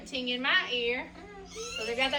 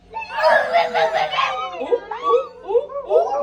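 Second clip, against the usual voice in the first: high, loud laughing ooh bellows; walrus bellow; 11 dB louder, much slower than usual. Shown as a demonstration. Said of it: "What do you think a walrus sounds like bellowing".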